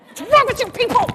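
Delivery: playful shout at the whining people; exasperated outburst. Retold or imitated. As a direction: blow up in comic frustration. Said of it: "if I had been Moses".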